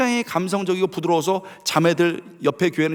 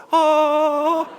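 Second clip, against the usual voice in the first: high sustained "ah", comically serene; sung harp note; 9 semitones higher than usual. Acted. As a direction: go straight back to the long note. Resumes playing after dodging spear. (아무 일 없다는 듯 높게 끄는 "아")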